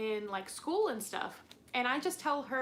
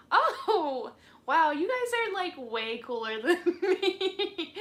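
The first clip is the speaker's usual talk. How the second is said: laughing; 7 semitones higher, 8 dB louder than usual. Real, not acted. Gushing at viewers, laughing.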